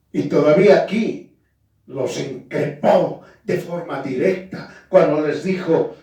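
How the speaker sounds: ordinary delivery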